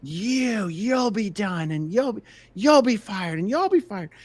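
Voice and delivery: high-pitched mock screaming, words drawn out; imitated screaming tirade. Imitating the boss berating his staff, high mock screaming. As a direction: mimic a tyrant threatening his people. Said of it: "when he was screaming at the top of his lungs".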